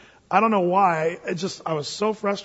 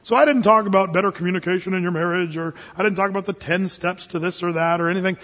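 ordinary talk, nothing marked

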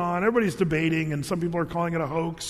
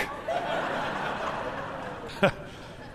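breathy laugh; 4 semitones higher, 6 dB quieter than usual. Real, not acted. Laughs after punchline.

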